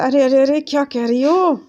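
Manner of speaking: fed up with her dropped H, calling out; frustrated outburst. Acted. Higgins scolding Eliza, frustrated and loud. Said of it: "गुस्सा हो जाता है".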